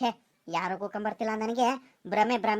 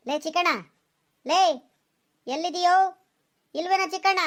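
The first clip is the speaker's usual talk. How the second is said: higher, louder call across the farm; calling louder and higher each time; 6 semitones higher, 3 dB louder than usual. Acted. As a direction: shout for your missing friend. Searching, calls out friend's name.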